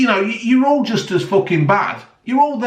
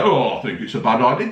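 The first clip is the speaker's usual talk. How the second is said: mock Boris; 7 semitones lower than usual. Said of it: "Boris is there".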